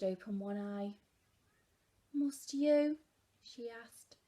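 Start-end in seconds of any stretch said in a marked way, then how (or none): 2.1-3.0 weary mother. A narrator's reading. Weary mother in read story.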